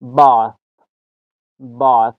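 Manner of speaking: "باث" mit langem britischem A, wie "baath"; model pronunciation. Shown as a demonstration. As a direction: sprich "باث" langsam vor, langes offenes A.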